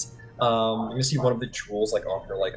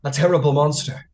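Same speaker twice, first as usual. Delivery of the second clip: raised, louder, dread-filled telling; fearful tale; 4 semitones higher, 5 dB louder than usual. Acted. Freed man warning of the monster in dread. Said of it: "looks around and goes".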